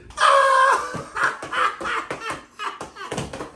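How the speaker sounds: high, long burst of laughter; acted cackle. Prankster watches his friend get in trouble, cackling. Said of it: "I'm over there like this".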